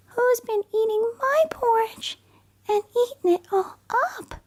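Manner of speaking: very high, tiny cub voice; teeny weeny Baby Bear voice. Acted. Baby Bear wailing that his porridge is gone, very high and tiny. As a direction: squeak it out like the smallest bear. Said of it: "cried teeny weeny Baby Bear in a teeny weeny voice".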